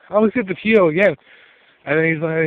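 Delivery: normal talking voice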